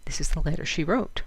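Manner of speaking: ordinary delivery, nothing marked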